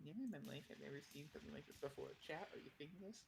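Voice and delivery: fast, breathless nerdy gushing; nerd voice. Pretend. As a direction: gush like an excited nerd. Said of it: "you're back to the nerd voice".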